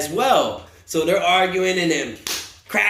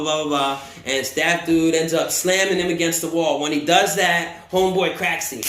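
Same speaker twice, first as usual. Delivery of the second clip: ordinary talk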